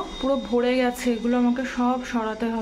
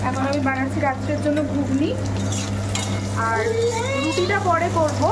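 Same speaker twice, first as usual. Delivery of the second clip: sing-song scolding; 7 semitones higher than usual. Real, not acted. Mother warning her toddler in the kitchen, high singsong. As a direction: warn the little one off, bright and high.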